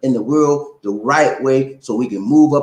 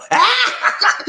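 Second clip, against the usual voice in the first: high-pitched burst of laughter. Real, not acted. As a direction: crack up at your own innuendo. Cracks up at own innuendo.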